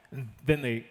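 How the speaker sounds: usual speaking voice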